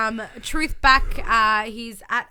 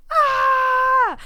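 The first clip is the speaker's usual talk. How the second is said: high, loud shocked yell; crowd shout; 9 dB louder than usual. Retold or imitated. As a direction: scream at the surprise. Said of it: "Everybody's like".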